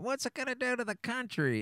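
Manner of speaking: host mocking anti-cannabis critics in a squeaky worried voice. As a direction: parody a clutching-pearls critic. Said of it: "anyone that's upset about".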